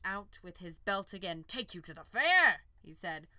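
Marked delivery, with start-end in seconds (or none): 1.4-2.6 louder, higher sweet-talk; loud coaxing. Acted. Farmer to the unicorn, loud eager coax. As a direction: call it out eagerly.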